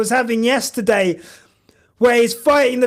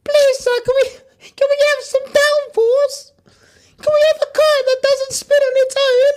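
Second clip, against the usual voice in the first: high, pleading Oliver voice, faster at the end; begging orphan; 10 semitones higher than usual. Retold or imitated. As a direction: beg the team like a starving orphan. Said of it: "feeding us shite, feeding us gruel".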